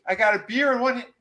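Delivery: high-pitched, giddy boasting; mock glee. Recapper as man delighted by his regrown hand, mock gleeful. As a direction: revel in it, voice way up.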